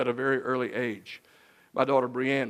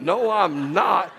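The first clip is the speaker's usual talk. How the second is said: slow, loud, higher refusal; stubborn husband; 5 semitones higher, 8 dB louder, much slower than usual. Retold or imitated. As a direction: dig in your heels, drawn out. Husband refuses new wallet.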